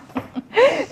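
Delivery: short burst of high laughter. Real, not acted. Laughing about fake taste test.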